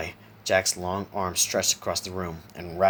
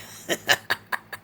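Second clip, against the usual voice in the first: short whispery villain cackle; cackle under breath. A narrator's reading. Clown amused by his own taunt, muffled cackle. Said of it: "cackled under his breath".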